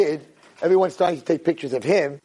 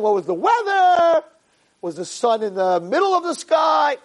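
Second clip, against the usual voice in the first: mock incredulous questioning; 8 semitones higher than usual. Retells lawyer's odd questions.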